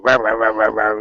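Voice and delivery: lightly imitated dog barking; rapid run of mock barks; Teeny. Fun-spoiling dog breaking up the others' play, mock barking. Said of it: "Teeny is the fun Nazi".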